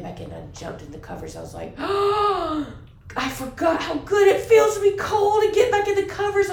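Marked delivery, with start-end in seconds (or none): 1.8-6.5 high, loud and slow, bursting with delight; gleeful shout. Retold or imitated. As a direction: shout it out like a thrilled kid diving under covers. Acted delight at cold morning.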